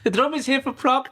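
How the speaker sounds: high, giggling joke; laughing through the words. Real, not acted.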